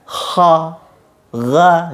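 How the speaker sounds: "ha" and "ga" with the lips pulled back; wrong spread kha. Shown as a demonstration. Teacher demonstrates lips drawn back, the wrong way. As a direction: show the mistake of pulling the lips back. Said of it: "tidak ditarik ke belakang".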